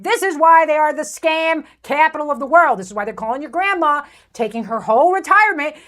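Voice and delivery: normal talking voice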